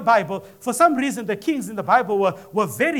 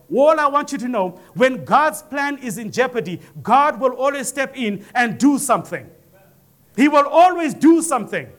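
rising preaching; 3 semitones higher, 3 dB louder than usual. Acted. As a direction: build the sermon to a ringing peak. Preacher builds to a climax.

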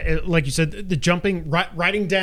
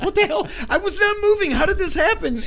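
high, incredulous outburst; baffled disbelief. Retold or imitated. Player retelling finding himself in the kitchen, high-pitched disbelief. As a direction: protest in bewilderment, pitched way up.

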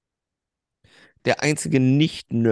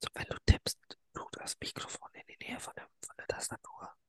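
hushed whisper; 15 dB quieter than usual. Real, not acted. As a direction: whisper so no one wakes up.

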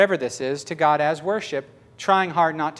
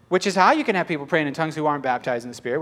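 ordinary talk

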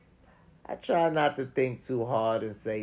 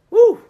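high loud woo of relief; end-of-day whoop; relieved whoop; 20 dB louder than usual. Retold or imitated. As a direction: let out a big tired whoop.

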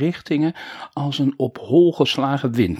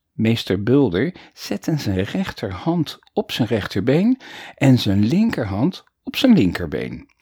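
plain reading voice

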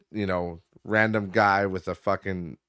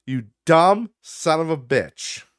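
slow, contemptuous jab; angry insult; 4 semitones higher, 6 dB louder, slower than usual. Real, not acted. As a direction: spit the insult out slowly.

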